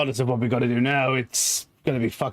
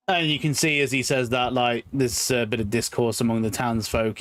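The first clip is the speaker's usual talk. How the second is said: ordinary talk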